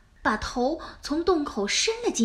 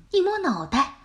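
ordinary delivery, nothing marked

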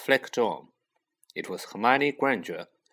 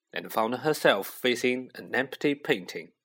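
usual speaking voice

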